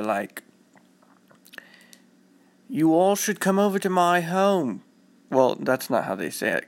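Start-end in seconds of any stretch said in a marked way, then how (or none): 2.7-4.8 high, British-sounding attempt at Boston; failed Boston accent. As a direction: try Boston, land somewhere British. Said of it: "that's more like British".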